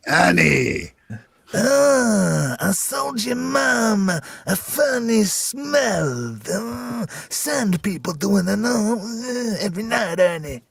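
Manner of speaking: high, slow Watto voice. Retold or imitated. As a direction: whine it like Watto. Are you doing Watto?.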